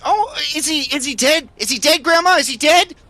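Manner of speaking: put-on a curious little kid shark asking grandma; baby shark voice. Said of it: "the baby shark says".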